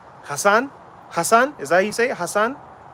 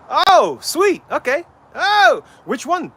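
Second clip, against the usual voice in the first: delighted; 12 semitones higher, 6 dB louder, slower than usual. Real, not acted. Got the name right.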